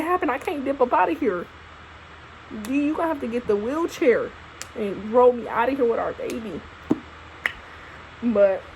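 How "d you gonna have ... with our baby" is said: ordinary talk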